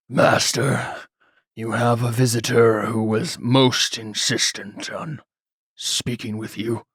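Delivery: nervous servant with a quaver. Acted. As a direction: let your voice quaver, intimidated. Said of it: "a quaver in his voice".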